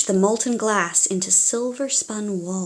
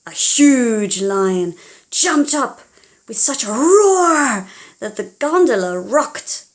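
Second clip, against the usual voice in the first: louder roaring narration; louder dramatic storytelling; dramatic build; 2 semitones higher, 6 dB louder than usual. A narrator's reading. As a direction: make the roar rock the boat. Lion roar narration.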